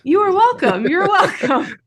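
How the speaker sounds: loud, high-pitched "you're welcome"; exuberant reply. Real, not acted. Guest answering thanks for the recommendations, exuberant. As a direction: belt it out high and playful.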